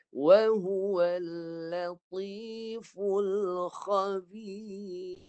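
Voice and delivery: Wa huwal drawn out very slowly in Quranic recitation. Shown as a demonstration. Teacher models Quran recitation for the student. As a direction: chant the verse slowly, heavy on the letters. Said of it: "Cuba baca".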